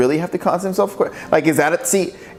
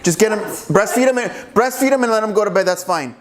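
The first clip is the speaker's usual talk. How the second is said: high, easygoing doctor's reassurance; breezy doctor; 7 semitones higher than usual. Acted. Doctor telling new parents to just breastfeed him, breezy. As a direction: brush off any worry in a higher voice. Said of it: "the doctor's like".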